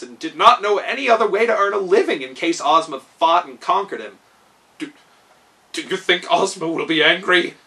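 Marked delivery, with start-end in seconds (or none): 4.8-7.6 stammering, anxious question; king fearing Ozma's anger, anxious stammer. Acted. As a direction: ask nervously, tripping on the start. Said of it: "he asked anxiously".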